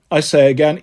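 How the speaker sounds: usual speaking voice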